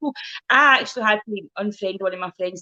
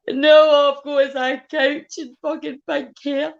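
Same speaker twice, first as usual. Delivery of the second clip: laughing; 5 semitones higher, 5 dB louder, much slower than usual. Real, not acted.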